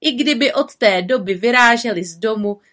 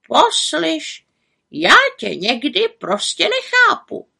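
higher raven voice, exasperated and preachy; scolding raven; 3 semitones higher, 4 dB louder than usual. A narrator's reading. Raven scolds the witch for neglecting herself, exasperated. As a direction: lecture her like a sensible raven.

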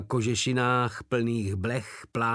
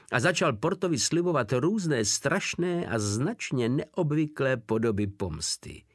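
ordinary delivery, nothing marked

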